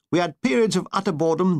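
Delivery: usual speaking voice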